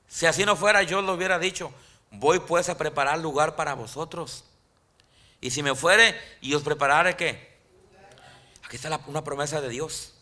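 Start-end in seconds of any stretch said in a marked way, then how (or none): 0.1-7.1 full-force sermon climax; fiery preaching. Acted. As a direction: lift the congregation with conviction.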